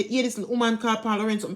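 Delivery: usual speaking voice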